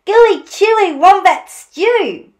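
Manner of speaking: high, loud proud announcement; hammed-up boasting for children; boastful Dingo. A narrator's reading. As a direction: show off the dish. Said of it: "boasted Dingo".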